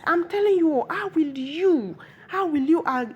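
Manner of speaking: high, loud, drawn-out reproach; outraged scolding. Retold or imitated. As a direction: act out an indignant queen confronting villagers. Queen confronts villagers in outrage.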